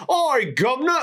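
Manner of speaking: high mock Cockney "Oi, governor". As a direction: ham up a Cockney maid accent. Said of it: "kind of British voice".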